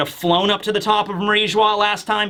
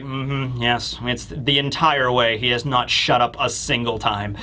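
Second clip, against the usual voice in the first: weary deadpan; 6 semitones lower than usual. Retold or imitated. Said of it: "Augur is like".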